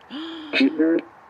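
a sudden high, loud gasp of fright; sharp gasp. Real, not acted. Vlogger startled by forest sounds, sharp gasp.

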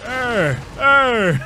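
high, loud mock growl; Brock growl. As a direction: growl it out like a cartoon brute. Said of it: "He does a lot of that a lot".

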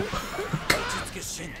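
squeaky high giggle, no voice; high breathy laugh. Real, not acted. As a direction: burst into a thin high laugh. Laughing at character's colour. (甲高い息だけの笑い)

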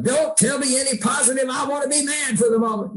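high, fast mock yell; hammed-up angry refusal; mock mad outburst. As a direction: snap back at anyone being positive. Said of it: "it's like".

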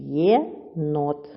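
"Єнот" in two separate syllables, Є, pause, нот; split into syllables. Shown as a demonstration. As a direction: break "Єнот" apart: Є... нот. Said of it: "поділ слова".

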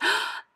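high, sudden gasp. A narrator's reading. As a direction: gasp in happy surprise. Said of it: "The woman gasped".

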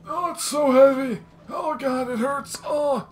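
high, loud mock agony; hammed-up strain. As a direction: overact carrying something heavy. Hamming up carrying a heavy flamethrower.

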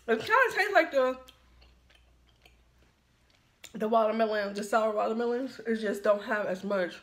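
talking around chewy candy; chewing while talking. Real, not acted. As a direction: mouth partly full. Seen 3: Candy put in mouth before; closed-mouth chewing while speaking.

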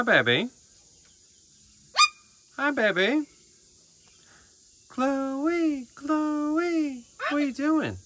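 very high, loud sing-song calls; calling pet bird. Real, not acted. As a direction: call her over like a beloved pet.

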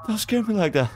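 high rattled plea; shaken high protest. Real, not acted. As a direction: voice still shaking from fright. Right after jump scare.

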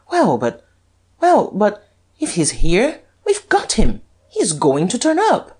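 high, excited exclamation; triumphant. A narrator's reading. Duke sure they have caught Lupin, triumphant. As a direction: build from halting start to jubilant high pitch. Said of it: "said the Duke triumphantly".

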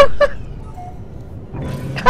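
breathy rising giggle; high airy laugh. Real, not acted. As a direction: laugh in disbelief. Laughing at game.

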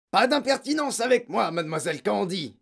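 high, loud snub that drops at the end; outraged headmistress's cry; shouted rebuke. A narrator's reading. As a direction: shout down an insolent subordinate. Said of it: "cria mademoiselle Legourdin".

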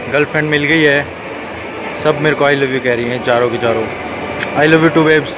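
usual speaking voice

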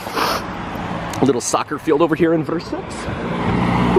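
ordinary delivery

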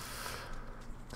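soft breathy sigh. Real, not acted. Gamer alone in the storm, soft sigh. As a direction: let out a long quiet breath.

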